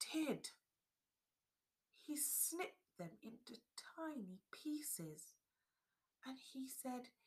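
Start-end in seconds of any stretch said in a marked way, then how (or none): 1.9-7.1 hushed, breathy telling; whispered narration. A narrator's reading. Narrator hushing as the dolls are cut up, whispered. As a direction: drop to a whisper for the sad moment. Sad turn in story.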